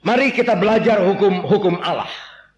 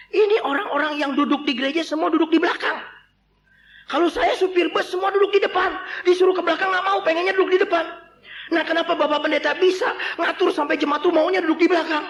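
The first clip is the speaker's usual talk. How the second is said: excited driver; 11 semitones higher than usual. Acted. Bus driver eagerly asks the pastor his secret.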